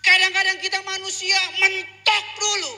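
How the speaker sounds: high, loud preacher's shout; full-force preaching. Real, not acted. Preacher admonishing the congregation about repentance, full-force preaching. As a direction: hammer it out at high pitch.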